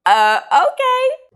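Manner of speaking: slow, high, doubtful drawn-out okay; unimpressed uh okay. Retold or imitated. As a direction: sound skeptical and underwhelmed. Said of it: "I was like".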